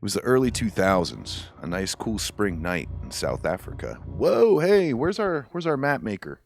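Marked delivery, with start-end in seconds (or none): 4.1-6.4 much higher, louder excited call-out. Real, not acted. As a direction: shout out eagerly for the mapmaker. Calling for the map maker.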